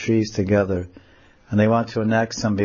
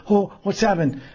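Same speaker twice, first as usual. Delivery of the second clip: startled; 10 semitones higher than usual. Acted. Startled girl in tree.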